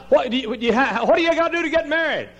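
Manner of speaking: high, stumbling, hurried question; mock flustered inquirer; imitated anxious questioner. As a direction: stammer it out high, then rush. Voices someone asking about weddings.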